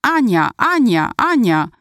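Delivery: high, drawn-out chant of a name; cheering crowd. A narrator's reading. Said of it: "zaczęli drzeć się na całe gardło". (przeciągły, wysoki doping)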